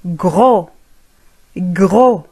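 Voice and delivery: gros ending on the O, no S sound; silent final s. Shown as a demonstration. Teacher drilling students on gros with silent S. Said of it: "لا ننطق حرف S".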